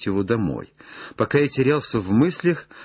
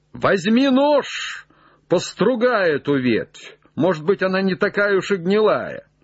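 high, raised instruction; calling up; 9 semitones higher than usual. Acted. Legrand tells Jupiter to test the branch, raised call. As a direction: send the words up to the treetop.

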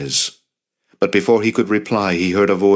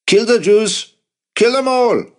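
very high, loud furious cry; mob shout; 5 dB louder than usual. A narrator's reading. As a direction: shout it out like a rioter. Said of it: "someone shouted".

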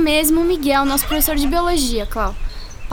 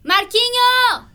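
long drawn-out call, pitched high; hollering his name from outside; 8 semitones higher, much slower than usual. Acted. Laura calling Marquinho from outside to hurry him up. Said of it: "não precisa gritar".

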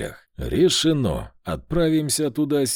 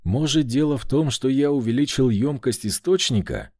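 ordinary delivery

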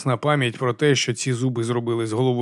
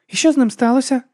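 curious Alex; 12 semitones higher, 5 dB louder than usual. Acted. Alex asking.

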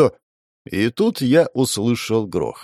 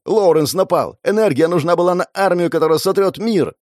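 urgent, higher-pitched warning; shouted alarm; 6 semitones higher than usual. Acted. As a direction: raise the voice to warn over noise. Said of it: "прокричала Мэй".

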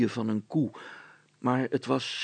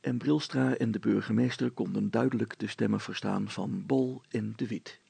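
plain reading voice

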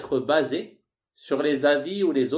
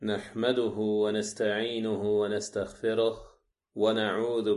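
measured monotone recitation, lower and level; formal liturgical Arabic on one steady low note; Arabic opening invocation; 4 semitones lower, faster than usual. Acted. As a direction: recite the sermon opening in Arabic, evenly and fluidly.

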